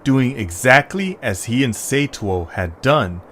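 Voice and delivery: low, even storyteller reading; story narration. A narrator's reading. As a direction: read it like a bedtime storyteller. Narrator reads story opening.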